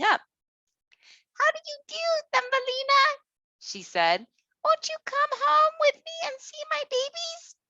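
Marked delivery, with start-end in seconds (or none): 1.4-3.0 very high squeaky little mouse; a tiny mother mouse greeting. Acted. Mrs Mouse greets Thumbelina. 4.6-7.6 very high squeaky little mouse; a tiny mother mouse greeting. Acted. Mrs Mouse invites Thumbelina.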